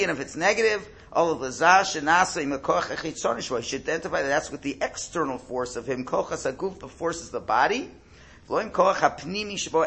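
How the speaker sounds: usual speaking voice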